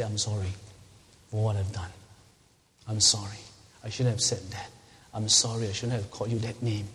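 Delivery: low, unhurried apology; sincere apology. Shown as a demonstration. Parent apologizing to a child for name-calling, sincere. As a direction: own up gently, pitched low.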